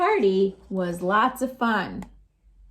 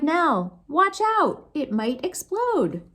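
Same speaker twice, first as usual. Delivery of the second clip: cried warning; 6 semitones higher, slower than usual. A narrator's reading. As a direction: shout the danger to Nell.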